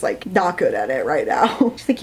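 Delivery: ordinary delivery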